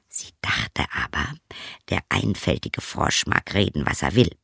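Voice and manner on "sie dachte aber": softly whispered aside. A narrator's reading. Narrator whispering to listeners about the princess's secret thought. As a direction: lean in and whisper it.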